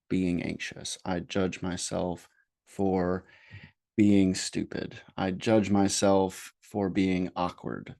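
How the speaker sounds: slow meditation voice with long pauses; guided meditation. Acted. Therapist leading listeners to name self-judgments, slow guided meditation. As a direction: guide them unhurriedly, leaving space after each phrase.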